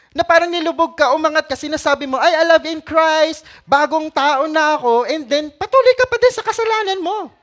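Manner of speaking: high, loud mock declaration; hammed-up pious claim. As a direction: send up the fake convert. Mocking hypocrite's claim.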